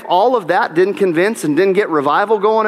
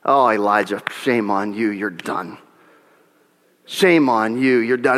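much lower, mock-stern scolding; stern scolding God; 7 semitones lower than usual. Preacher mimicking a harsh God scolding Elijah, lower and stern. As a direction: scold like a disappointed judge.

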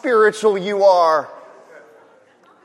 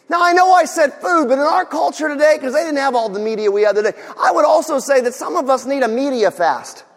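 higher, louder, fervent sermon voice; full-force preaching; 8 semitones higher, 6 dB louder than usual. Acted. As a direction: drive it home to the congregation.

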